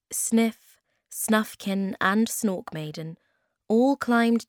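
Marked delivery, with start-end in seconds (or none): none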